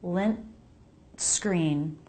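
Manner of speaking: "Lint", a tiny stop for the T, then "screen"; "Lint" with a brief T break before "screen". Shown as a demonstration. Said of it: "We need that little break".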